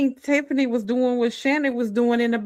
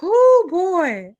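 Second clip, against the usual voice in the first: whoo; 9 semitones higher, 6 dB louder than usual. Real, not acted. Reacting to the clip.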